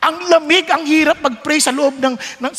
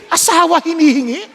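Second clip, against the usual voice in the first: normal talking voice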